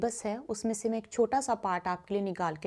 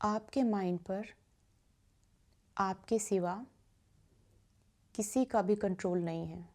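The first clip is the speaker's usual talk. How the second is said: guided meditation; 2 semitones lower, 6 dB quieter than usual. Acted. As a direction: guide listeners gently into stillness, softening on repeated phrases. (धीमी, शांत ध्यान कराने वाली आवाज़)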